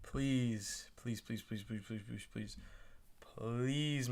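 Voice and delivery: hushed, pleading please repeated softly; quiet begging. Real, not acted. Fan pleading quietly for his team's pick. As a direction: beg under your breath. Begging team to draft Lemon.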